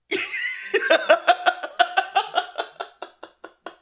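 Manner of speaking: long high wheezing laugh; breathless laughing fit; helpless laughter. Real, not acted. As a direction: laugh till no air is left. Sound of the speaker's own laughter.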